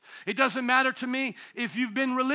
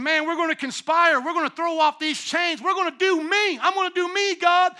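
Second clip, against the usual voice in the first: hammed-up defiant rebels, higher and louder; mocking rebels; 6 semitones higher, 5 dB louder than usual. Preacher mocking nations defying God, hammed-up defiant voice. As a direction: sneer it like a crowd throwing off God.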